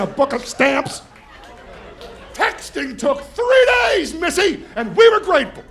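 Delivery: put-on loud, high old-timer rant; grumpy old man. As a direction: scold the kids like a cranky grandpa.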